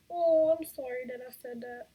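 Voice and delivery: high, cooing apology. Real, not acted. Mother mock-apologises to fussing baby.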